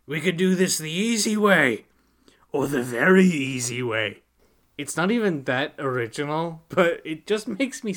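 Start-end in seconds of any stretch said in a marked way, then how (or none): 0.0-4.2 menacing wizard. Retold or imitated. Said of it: "one wizard tells Rincewind".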